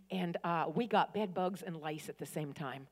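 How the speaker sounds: ordinary talk, nothing marked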